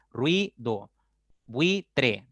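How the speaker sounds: "Ruido" and "buitre" each in two stretched syllables; slow pronunciation demo. Shown as a demonstration. Teacher asking students to notice the mouth, "Ruido, buitre" drawn out. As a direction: say "Ruido", then "buitre", slowly split. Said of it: "pronunciando la segunda vocal del diptongo".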